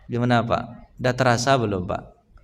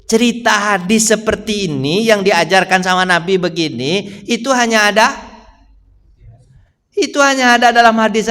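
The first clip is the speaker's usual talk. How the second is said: raised, insistent scholar's verdict; quoting Imam Nawawi; 5 semitones higher, 3 dB louder than usual. Retold or imitated. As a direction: hammer home that it is only stories. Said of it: "Apa kata Imam Nawawi".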